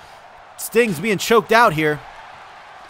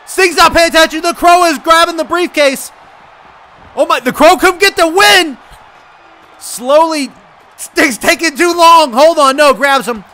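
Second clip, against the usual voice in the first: loud, raised-pitch play-by-play; tense excited call; 8 semitones higher, 7 dB louder than usual. Real, not acted. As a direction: shout as if the win is seconds away. Match nearly won, called live.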